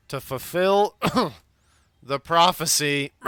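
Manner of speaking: slow, choking on spice; coughing through it. Acted. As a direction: cough between the words. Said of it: "I think I've got some spice stuck in my throat".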